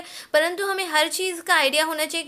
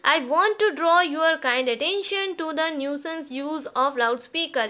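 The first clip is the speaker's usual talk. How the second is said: slow dictation; much slower than usual. Shown as a demonstration. Teacher dictating model letter line.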